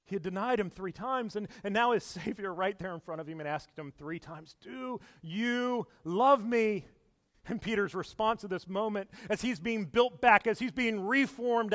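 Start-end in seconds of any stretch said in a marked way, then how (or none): 4.6-6.8 very slow, drawn out; a slow, tender, searching question; tender Jesus. Acted. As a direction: stretch each word gently. Jesus' third question recalled.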